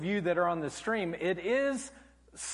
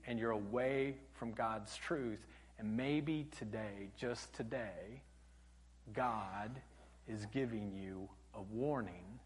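soft, slow, intimate plea; hushed appeal; 9 semitones lower, 13 dB quieter than usual. Real, not acted. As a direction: lean in and press it gently home. Preacher softens to a hush.

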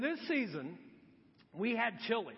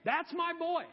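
higher and louder, insisting to the servants; a mother vouching for her boy, pushing them to obey; mock-commanding mom to the servants; proud bossy mom; 8 semitones higher, 4 dB louder than usual. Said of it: "I can just imagine her going".

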